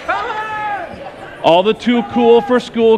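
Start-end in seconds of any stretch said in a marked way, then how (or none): 0.1-0.4 calling out. Real, not acted. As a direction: pitch it way up and call them to the stage. Calling kids up to the stage.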